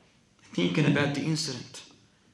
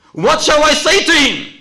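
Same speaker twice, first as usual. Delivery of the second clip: loud, very high desperate cry; 17 dB louder than usual. Acted. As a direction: shout the question at full voice. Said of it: "His brother cries out".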